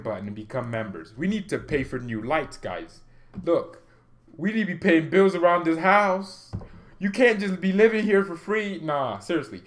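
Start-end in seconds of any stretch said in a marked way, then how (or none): 3.4-8.8 loud, high mock household scolding; mock nagging parent. Streamer begging viewers to pay for lights, mock parental scolding. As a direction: raise pitch and volume in playful outrage.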